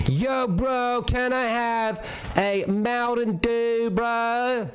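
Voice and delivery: high, slow, drawn-out dude voice; mocking Z-Laner voice. Streamer mocks another streamer's dude voice. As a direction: stretch it out like a whiny bro asking for a drink. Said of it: "there's no way he goes".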